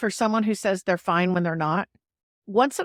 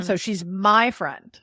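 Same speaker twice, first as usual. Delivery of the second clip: "my" spiked; slow, with a sharp lift on "my"; stress on MY; slower than usual. Shown as a demonstration. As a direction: punch "my" in "she's my friend". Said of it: "I'm just spiking that "my".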